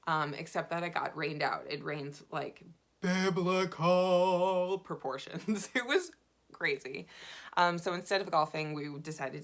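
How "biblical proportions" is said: BIBLICAL stretched long and loud; drawn-out exaggeration. Real, not acted. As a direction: blow up "biblical" with slow, booming emphasis. Exaggerating how hard it rained.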